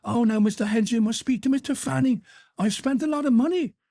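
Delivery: high whiny mock excuse; sneering high imitation of a stingy man; mocking the shoe boy. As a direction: ape his pleading. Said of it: "instead of".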